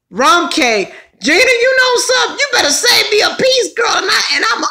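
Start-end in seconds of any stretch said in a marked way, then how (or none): 1.2-4.7 excited. Real, not acted. Begging viewer to save cake.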